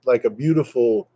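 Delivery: ordinary talk, nothing marked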